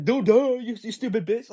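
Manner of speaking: retold jeer at an obvious question; scornful retort. Boyfriend repeats his comeback to girlfriend, scornful.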